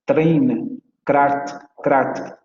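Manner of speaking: Train and crat said with a rolled Spanish R; teacher imitating the South African trilled r. Shown as a demonstration. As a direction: roll the r in Train and crat hard. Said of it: "van a pronunciarlo con la vibrante múltiple".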